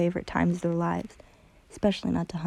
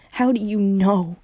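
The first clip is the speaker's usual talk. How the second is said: a sharp, louder retort; spat; 6 dB louder than usual. A narrator's reading. Hunter lashing out at Luz, spat angrily. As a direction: spit it back at her, hurt and angry. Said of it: "Hunter spat".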